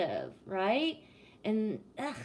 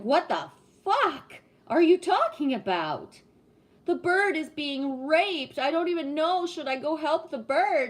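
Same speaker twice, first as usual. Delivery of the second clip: high, loud, hammed-up confused bystander; mock baffled woman; 5 semitones higher, 6 dB louder than usual. Retelling a woman who saw birds mating, mock alarmed. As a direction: play the clueless onlooker, exaggerated. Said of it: "And I was like".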